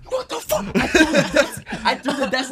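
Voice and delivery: high-pitched mock outrage; freaking out. Class clown retelling his paper tantrum, mock freak-out. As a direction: squeal it in hammed-up disbelief. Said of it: "I'm freaking out".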